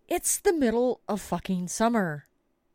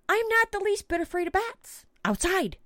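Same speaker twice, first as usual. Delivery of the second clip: higher-pitched, defensive protest; exasperated insistence; 10 semitones higher than usual. Real, not acted. Storyteller defends her fear of bats to listeners, exasperated. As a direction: insist indignantly, pitch raised.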